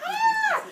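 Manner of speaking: shrieking tooth, hammed up; high mock scream. Voicing the tooth for the kids, high mock shriek. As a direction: squeal way up high like a scared tooth.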